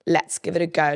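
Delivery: ordinary delivery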